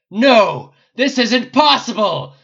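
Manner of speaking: dragon bellowing. A narrator's reading. The dragon watching its minions die, bellowing. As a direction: bellow in disbelief. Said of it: "the dragon bellowed".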